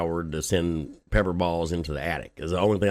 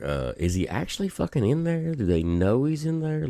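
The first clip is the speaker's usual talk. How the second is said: incredulous neighbors; 8 semitones higher than usual. Retold or imitated. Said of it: "me and the neighbors are like".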